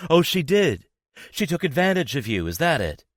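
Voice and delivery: high, loud mocking disbelief; bitter sarcasm. A narrator's reading. Jealous girlfriend mocking his excuse, loud and sarcastic.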